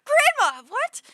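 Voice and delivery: put-on high, loud disbelieving child; childish outburst. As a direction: yell it like a shocked little kid. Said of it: "And I'd be like".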